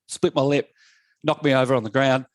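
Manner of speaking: ordinary delivery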